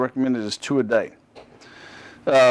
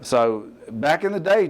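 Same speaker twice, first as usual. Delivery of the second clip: usual speaking voice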